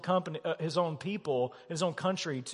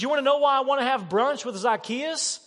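higher and louder, pressing the point; loud, eager Jesus; 6 semitones higher, 4 dB louder than usual. Acted. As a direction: voice Jesus with urgent excitement. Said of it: "And Jesus says".

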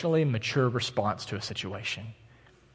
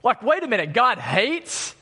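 usual speaking voice

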